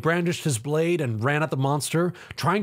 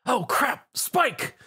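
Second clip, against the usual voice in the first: alarmed cry; 11 semitones higher, much slower than usual. Acted. Hero sees friend in danger.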